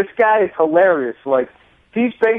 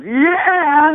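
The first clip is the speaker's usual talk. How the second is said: crowd yell; 13 semitones higher than usual. Retold or imitated. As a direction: call out like a fan in the dark. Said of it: "somebody else in another part of the crowd goes".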